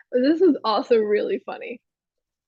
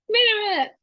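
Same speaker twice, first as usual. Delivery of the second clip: little alien voice; 10 semitones higher than usual. Retold or imitated. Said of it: "the little guy's like".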